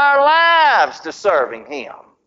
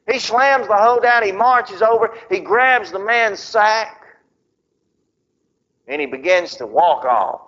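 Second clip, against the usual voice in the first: usual speaking voice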